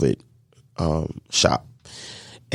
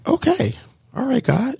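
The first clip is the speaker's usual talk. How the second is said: high, stunned okay, all right God; amazed disbelief. Retold or imitated. Pastor quoting his stunned thanks to God, high and amazed. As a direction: squeak it out in happy disbelief. Acted amazed reply to free rent.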